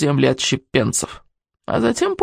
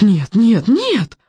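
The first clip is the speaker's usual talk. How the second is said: protesting; 3 semitones higher, much slower than usual. A narrator's reading. Boy protesting in horror.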